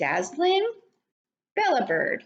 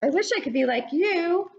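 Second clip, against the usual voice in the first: admiring ladybug; 8 semitones higher than usual. Acted. Lucy admiring the caterpillar, high and bright with longing. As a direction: gush over her spots in a high voice. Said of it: "called Lucy".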